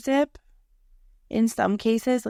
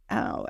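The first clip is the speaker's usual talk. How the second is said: low groaned ow; 14 semitones lower, 4 dB quieter than usual. Retold or imitated. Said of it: "you're just like".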